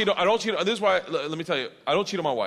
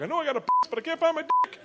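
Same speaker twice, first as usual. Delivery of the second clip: put-on high, slurry drunk confusion; sloppy drunk bewilderment. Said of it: "You ever been so drunk".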